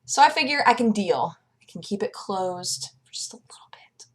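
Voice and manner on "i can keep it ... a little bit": breathy near-whisper, voice dropped right down; half-whispered aside to the camera. Real, not acted. Vlogger whispering to viewers about the heat.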